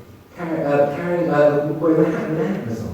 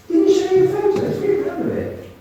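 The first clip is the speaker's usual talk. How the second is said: pitched far higher, lively and delighted; eager, proud. Retold or imitated. Janet telling the doctor about Gordon's photo, delighted and high.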